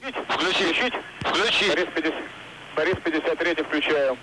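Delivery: astronaut radio. Real, not acted. Mir crew radio transmission.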